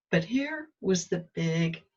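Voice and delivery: usual speaking voice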